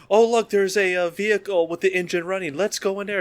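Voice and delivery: high-pitched mock eagerness; naive horror victim. Horror victim spotting a running car, naively eager. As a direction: play a clueless movie victim, pitched well up.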